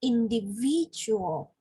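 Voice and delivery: "individual" drawn out slowly, stress on VID; teacher's model of "individual", leaning on the third syllable. Shown as a demonstration. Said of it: "the third stress".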